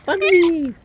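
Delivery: high loud call of a pet's name. Real, not acted. Calling bird by name.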